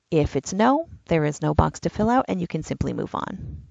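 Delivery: ordinary talk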